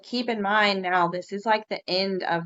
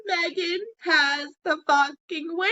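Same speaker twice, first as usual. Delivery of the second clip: ecstatic announcement; 9 semitones higher than usual. Retold or imitated. Announcing Megan's winning fish, ecstatic. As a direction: proclaim it like a victory cry.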